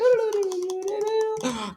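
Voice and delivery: a sudden high gasp of wonder; high dramatic gasp. Acted. Dreamer gasps high in amazement.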